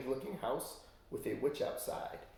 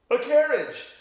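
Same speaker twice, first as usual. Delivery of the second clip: loud, high exclamation; excited big reveal; reveal shout; 14 semitones higher, 12 dB louder, slower than usual. Real, not acted. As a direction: burst out the answer. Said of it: "yell out loud what you're gonna guess".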